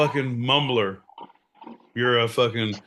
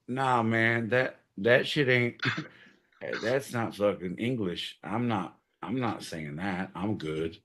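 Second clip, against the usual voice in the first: softer, lower laughing mutter; muttered refusal; 5 semitones lower, 7 dB quieter than usual. Real, not acted. Refusing to keep singing, muttered. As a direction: back off, mumbling.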